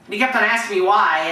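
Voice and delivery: ordinary talk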